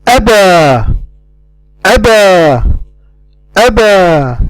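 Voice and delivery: aba with the final alif held long; drawn-out aba. Shown as a demonstration. Teacher modelling aba for learners. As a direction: stretch the end of aba without a glottal stop.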